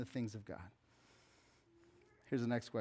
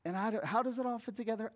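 ordinary talk

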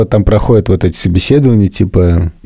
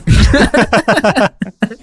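high laugh; 14 semitones higher than usual. Real, not acted. Laughs at own joke.

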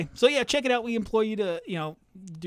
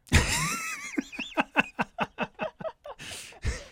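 big laugh. Real, not acted. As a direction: let out a huge cackling laugh. Laughing at acronym joke.

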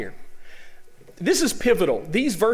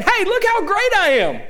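high, hammed-up bragging shout; mock boasting; 13 semitones higher, 4 dB louder than usual. Preacher mimics someone going around saying it, boastful. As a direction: play a show-off crowing for attention.

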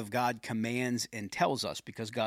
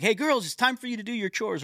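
put-on mild raised call to the kids, higher pitched; retold yell; 9 semitones higher, 3 dB louder than usual. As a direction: call out to your daughters, lightly raised. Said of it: "I just kind of yell".

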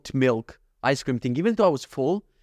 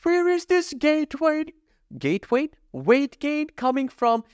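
much higher, slow, exaggerated baffled voice; hammed-up cluelessness; mock puzzled self; 13 semitones higher, slower than usual. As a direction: play dumb about your own weight gain. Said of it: "And I'm like".

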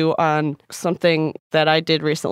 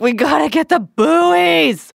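high, loud, very slow dreamy shout; sleep yelling; 9 semitones higher, 5 dB louder, much slower than usual. Retold or imitated. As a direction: call it out slow, half asleep. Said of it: "And I was like".

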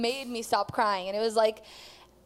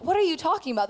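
higher-pitched, disbelieving question; incredulous inner voice; 7 semitones higher, faster than usual. Retold or imitated. One side of her brain questions the other, incredulous. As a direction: act out the doubting side of the brain. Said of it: "two sides of my brain".

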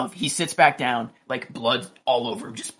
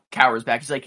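usual speaking voice

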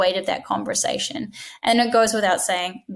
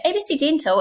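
bright friendly phone greeting, higher; nice tone; 7 semitones higher than usual. Shown as a demonstration. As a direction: greet the caller like you're glad they rang. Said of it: "nice tone".

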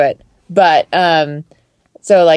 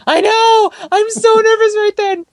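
much higher, laughing through it; nervous laughing reply. Retold or imitated. Her own nervous reply retold.

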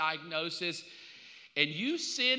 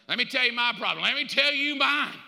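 louder, higher mock boast; one-upping each other's troubles, loud and high; 4 semitones higher, 6 dB louder than usual. Preacher mocking the congregation's competing troubles, loud.

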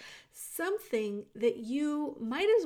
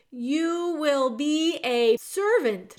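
slow, loud, higher repetition; shouted; 5 semitones higher, 9 dB louder, slower than usual. A narrator's reading. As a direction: shout it word by word for deaf ears. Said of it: "shouted the soldier".

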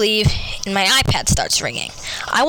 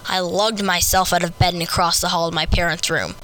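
ordinary delivery, nothing marked

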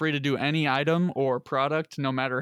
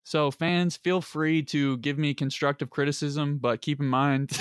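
ordinary talk

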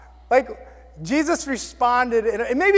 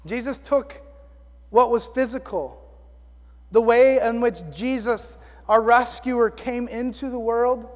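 normal talking voice

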